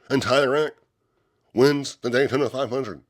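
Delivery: thrilled replay of the finish; excited call. Retold or imitated. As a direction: call the win with rising excitement. Retelling the winning final lap.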